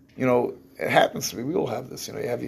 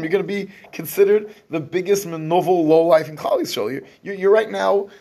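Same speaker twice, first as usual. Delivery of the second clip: retold sharp warning, pitched up; pointed, higher-pitched scolding, acted out; speaking very sharply; 6 semitones higher, 4 dB louder than usual. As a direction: voice her sharp rebuke to him. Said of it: "She spoke very sharply".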